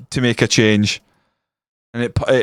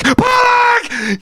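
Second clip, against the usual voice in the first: very high, drawn-out scream of Pollock; full scream. Real, not acted. Fan screaming as Pollock scores, full scream. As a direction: let Pollock ring out at the top of your voice. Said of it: "some guy yelling out their window".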